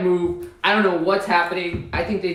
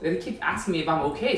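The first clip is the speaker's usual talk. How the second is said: ordinary talk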